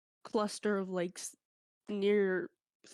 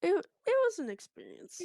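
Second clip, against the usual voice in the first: laughing; 10 semitones higher than usual. Real, not acted. Laughing after story.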